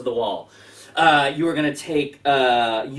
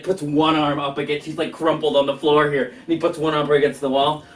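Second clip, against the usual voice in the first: ordinary talk, nothing marked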